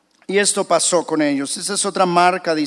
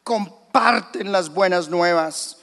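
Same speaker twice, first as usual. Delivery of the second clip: ordinary delivery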